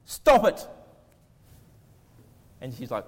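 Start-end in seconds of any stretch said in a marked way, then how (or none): 0.1-0.7 sudden loud, high-pitched shout; mock yell. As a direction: yell it as the exasperated counsellor in a sketch. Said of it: "he yells at her and says".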